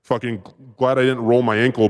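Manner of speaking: ordinary talk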